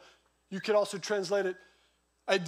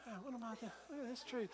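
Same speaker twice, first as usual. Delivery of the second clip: quiet musing; 16 dB quieter, faster than usual. Retold or imitated. Pastor talks to himself at tree.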